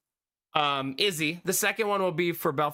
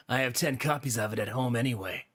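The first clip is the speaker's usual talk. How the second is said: character's dialogue; 6 semitones lower than usual. A narrator's reading. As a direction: lower voice for the man. Reading a male character's line aloud.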